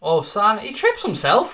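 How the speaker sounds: sudden high, loud exclamation; surprised outcry. Real, not acted. Streamer reacting as Sané trips, surprised outcry. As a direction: blurt out in shock as he falls.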